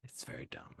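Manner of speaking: whispered aside, unvoiced. Real, not acted. Host agrees with co-host in a whisper. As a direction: breathe it as a sly aside.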